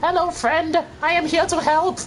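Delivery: very high, squeaky little helper; chirpy high voice for an in-game buddy; cute helper voice. Pretend. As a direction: pitch way up like a tiny cartoon companion. Voicing a helper companion.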